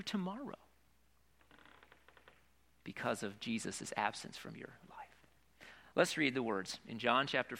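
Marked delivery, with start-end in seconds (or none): 2.8-5.0 soft whispered close; whispered sermon point. Real, not acted. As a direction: let the line fall to a whisper. Sermon drops to hush.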